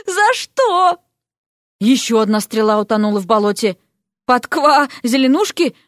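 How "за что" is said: slow, high despairing plea; imploring wail. A narrator's reading. Vasya asks heaven why she suffers, imploring wail. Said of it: "снова взмолилась Вася".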